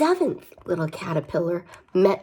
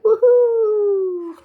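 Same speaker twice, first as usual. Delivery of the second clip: high, loud whoop; gleeful high woohoo; caterpillar cheering; 6 dB louder than usual. Acted. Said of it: "He's like".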